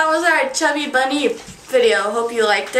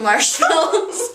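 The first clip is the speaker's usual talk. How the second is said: cheeks stuffed full; 3 semitones higher, slower than usual. Real, not acted. Mouth full of marshmallows.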